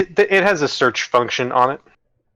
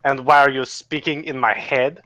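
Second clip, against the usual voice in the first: shaky attempt at German, sliding into French; botched German accent; 3 semitones higher than usual. Game master as alien to telepathic mushroom, botched German accent. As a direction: speak English with a bad German accent. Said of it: "It was supposed to be German".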